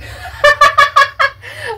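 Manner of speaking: very high, squealing laugh. Real, not acted. Amused by the reply about breakfast, high laughter. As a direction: burst into high-pitched laughter.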